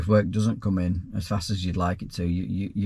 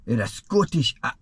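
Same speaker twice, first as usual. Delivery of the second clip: put-on a brief, abandoned try at a Scottish accent; half-hearted Scottish accent; 3 semitones higher than usual. Asked by a viewer to read the question, a half-hearted Scottish accent. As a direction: start the line in a Scottish accent, then give up. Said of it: "supposed to say that in Scottish".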